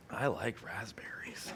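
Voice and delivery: put-on breathy dazed child whisper, slow; dreamy whisper. Boy on laughing gas whispering to the nurse, slow and dreamy. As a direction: whisper it like a kid floating on happy gas. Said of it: "He goes".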